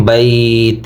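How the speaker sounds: "বাইত" lengthened, then stopped on t; "বাইত" with no ending vowel; pausal pronunciation. Shown as a demonstration. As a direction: hold the glide and halt on the t of "বাইত". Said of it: "থেমে যায়।".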